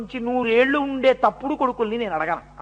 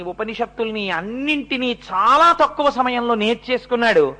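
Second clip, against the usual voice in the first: ordinary talk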